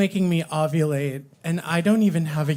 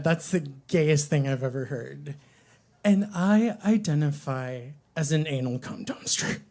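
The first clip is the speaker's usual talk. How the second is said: normal talking voice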